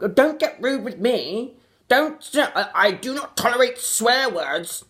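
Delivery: high, prissy mockery of an offended snob; mock prim offended voice. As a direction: squeak it out like a pearl-clutching prude. Mocking an offended prim person.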